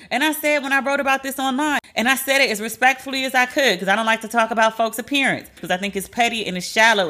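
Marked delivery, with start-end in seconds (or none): none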